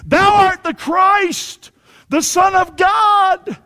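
high, loud, slow-stretched shout; crying out. Acted. Devils cry out on recognizing Christ, high drawn-out shout. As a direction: cry it out with every word held long. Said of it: "crying out and saying".

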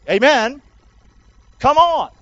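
ordinary talk

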